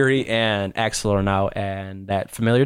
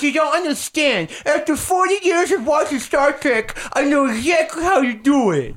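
put-on high, slow, strained man-child voice; Bobby Boucher; 13 semitones higher than usual. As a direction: protest slowly in a high put-on voice. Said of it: "My name's Bobby Boucher".

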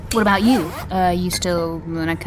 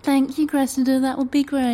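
higher sing-song mocking copy; mocking imitation; 6 semitones higher than usual. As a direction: mimic him to mock him. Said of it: "Would you just stop?".

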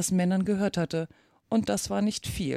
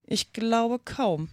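langsam, hoch und zögernd; vorsichtig; 4 semitones higher, much slower than usual. A narrator's reading. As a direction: behutsam und gedehnt erwidern. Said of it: "erwiderte Alice vorsichtig". (vorsichtig zögernd)

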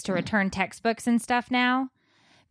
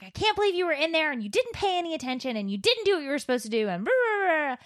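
fast, high rapid-fire scolding; mock rant; 7 semitones higher, faster than usual. Mom going off at her daughter, fast high scolding. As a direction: rattle off a mother's rant. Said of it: "I just started going off".

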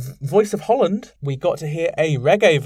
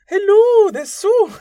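squeaky, much higher and louder bad Scottish accent; a hammed-up Scottish accent that misses badly; 9 dB louder, slower than usual. Podcaster quoting [name] in a failed Scottish accent. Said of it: "How would you even do a Scottish accent?".

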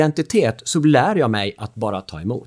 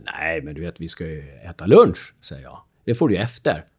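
calm refusal; 7 semitones lower than usual. Retold or imitated. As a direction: stay calm and unmoved. Dad after course, calm refusal.

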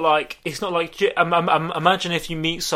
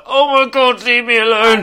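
exasperated; 6 semitones higher, 6 dB louder than usual. Retold or imitated. Annoyed, phone buzzing nonstop.

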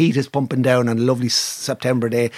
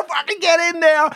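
high-pitched retold victory cry; triumphant, pitched way up; jubilant cheer. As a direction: imitate a jockey celebrating a win. Jockey celebrating the win, retold.

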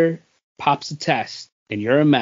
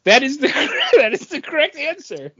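laughing; 11 semitones higher, 4 dB louder, faster than usual. Real, not acted. Host laughing while confirming answer.

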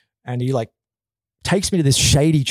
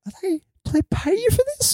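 surprised; faster than usual. Retold or imitated. Confused during surprise massage.

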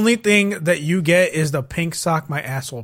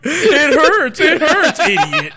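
higher, louder sing-song taunting "It hurts"; mocking taunt; 11 semitones higher, 5 dB louder, much slower than usual. Friend imitating the killer mocking his victim, higher sing-song taunt. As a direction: jeer at the victim like a bully. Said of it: "like, mocking you".

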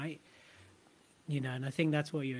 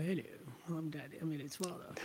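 posh dad impression; 10 dB quieter than usual. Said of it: "I made him even posher".

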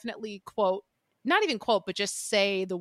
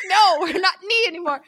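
higher, louder, laughing mock correction; knight correcting them; 9 semitones higher, 7 dB louder than usual. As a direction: huff at them in a put-on knight voice. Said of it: "And he's like".

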